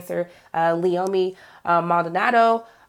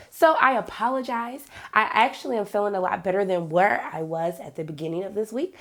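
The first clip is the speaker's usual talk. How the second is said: ordinary talk, nothing marked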